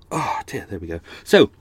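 normal talking voice

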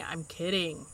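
soft, low retraction; quiet backing down. Acted. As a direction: drop the voice to back off. Backing off after a rebuke.

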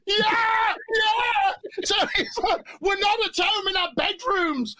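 excited. Retold or imitated. Reliving the excitement.

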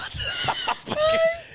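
very high shrieking burst of laughter; real high laughing shriek. Real, not acted. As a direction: squeal with laughter. Laughing at own joke.

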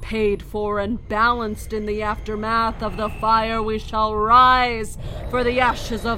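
zealous cult leader declaring victory; fervent sermon. Acted. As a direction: preach it to the crowd at full force. Leader preaching over the carnage.

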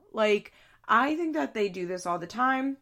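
ordinary delivery

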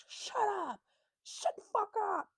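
high, mock yell of shut up; Clara yelling. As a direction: imitate Clara telling him to shut up. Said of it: "Just like Clara said".

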